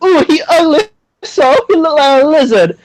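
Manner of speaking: high, put-on Jamaican grandma accent; mock Jamaican accent, grandma judging. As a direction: try a Jamaican accent, a bit weak. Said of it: "She's Jamaican, and she'll be like".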